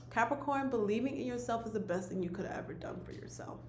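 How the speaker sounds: hushed, moved whisper, voice dropping away; emotional whisper. Real, not acted. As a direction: whisper it, overcome with real feeling. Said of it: "oh wow, I'm getting emotional".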